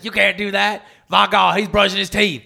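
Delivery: hammed-up ringside shock; mock commentator. Commentating someone brushing teeth, mock outraged call. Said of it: "and they're like".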